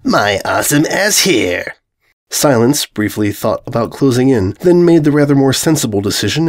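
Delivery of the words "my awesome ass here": slow, whining self-important boast; the invader repeats his boast, slow and whiny; whiny villain boast. Acted. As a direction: whine it like a would-be conqueror. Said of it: "screwed up the first word in two different ways".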